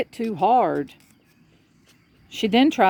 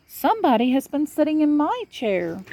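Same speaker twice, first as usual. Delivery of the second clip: higher, mama bear voice; middle-sized bear; 8 semitones higher than usual. A narrator's reading. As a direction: play the middle bear, higher than papa.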